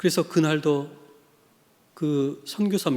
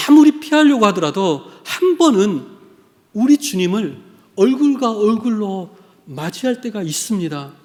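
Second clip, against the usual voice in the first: loud, high challenge from the pulpit; impassioned preaching; 4 semitones higher, 7 dB louder than usual. Real, not acted. Preacher challenging listeners about facing the Lord, impassioned. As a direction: press the question on the congregation with force.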